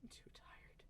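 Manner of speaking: soft whispered sigh; exhausted whisper. Acted. Elf to herself, drained, whispered. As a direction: barely breathe it.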